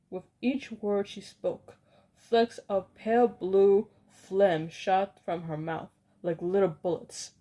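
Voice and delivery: ordinary delivery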